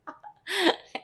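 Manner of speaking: brief high-pitched chuckle; short laugh. Real, not acted. Reader amused by her own remark, short laugh. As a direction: let out a quick laugh.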